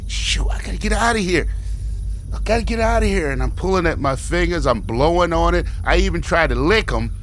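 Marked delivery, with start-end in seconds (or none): 0.1-3.3 panicked muttering. Retold or imitated. Panicked thought, stuck at car.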